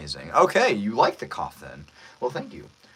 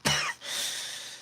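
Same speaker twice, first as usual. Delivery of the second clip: acted wounded cough; breathy villain coughing fit; beat-up cough; 6 dB quieter than usual. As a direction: cough through the injury. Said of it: "One more line with the cough".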